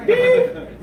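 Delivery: short piercing mock alarm; imitated scanner beep. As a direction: beep like a metal detector. Imitates airport security scanner.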